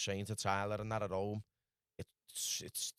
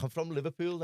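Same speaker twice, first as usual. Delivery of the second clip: retold own reply; 8 semitones higher, 4 dB louder than usual.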